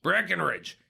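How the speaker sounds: rising excitement, pitch climbing high; wrestling commentator. Acted. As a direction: hype it up like live ringside call. Live play-by-play, climbing to top rope.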